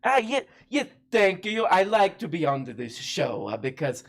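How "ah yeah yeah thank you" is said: put-on a lightly done, hammy Italian accent; bad Italian accent. Guest on the show in a hammy Italian accent. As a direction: play a fake Italian chef, a little higher. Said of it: "your Italian accent, not the best".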